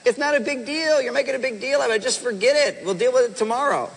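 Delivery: high, breezy brush-off of her worries; dismissive husband. Retold or imitated. Husband waving off his upset wife, high breezy dismissal. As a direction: wave it away like it's nothing. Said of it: "you say".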